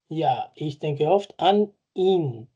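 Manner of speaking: slow, careful German example; deliberate teacher pace; slow model sentence. Shown as a demonstration. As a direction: read it slowly for learners. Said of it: "aquí no se usa".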